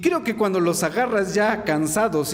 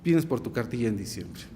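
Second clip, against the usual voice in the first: softer, low-pitched retold officer; low verdict; 7 semitones lower, 6 dB quieter than usual. Officer telling draftee to collect his card, low and quiet.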